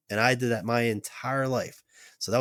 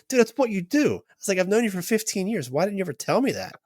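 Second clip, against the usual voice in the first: much higher, disbelieving outburst at his friend; incredulous; 9 semitones higher than usual. Retold or imitated. Retold disbelief at old friend.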